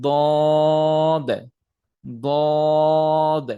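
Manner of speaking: দদ said thick and loud; heavy dad. Shown as a demonstration. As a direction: say দদ full and heavy. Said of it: "তারপর হচ্ছে".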